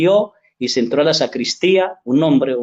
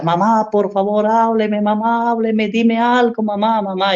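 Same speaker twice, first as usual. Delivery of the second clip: high, pleading cry for a dead mother; retold crying plea; 6 semitones higher than usual. Daughter pleading over her dead mother, high and crying. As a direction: beg her mother to speak, voice lifted high. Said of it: "y lloraba y clamaba".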